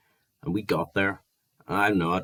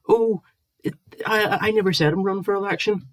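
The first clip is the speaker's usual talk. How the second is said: much higher, flustered mocking squeak; mock defensive whine; 13 semitones higher than usual. [name] mimics Gareth denying he is running for election, high flustered whine. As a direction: play him flustered and whiny.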